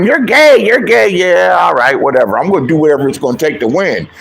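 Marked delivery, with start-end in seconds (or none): 0.0-1.1 mock taunt.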